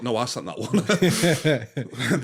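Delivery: slow, drawn-out Homer line through laughter; Homer impression. Podcaster doing Homer confessing about the letter, slow and laughing. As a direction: drag it out like Homer, half laughing. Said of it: "And Homer's like".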